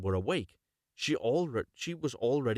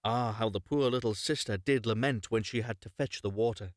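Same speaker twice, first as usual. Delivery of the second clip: plain reading voice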